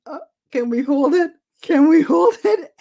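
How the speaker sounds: high, loud, desperate plea; a nervous, pleading cry; anxious hoping. Retold or imitated. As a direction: fans begging the clock to run out. Anxious fans watching the match.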